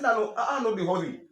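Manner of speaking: high, loud insistence. Retold or imitated. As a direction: push back hard, pitch up high. Retold reply to magistrate.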